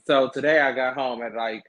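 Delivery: ordinary talk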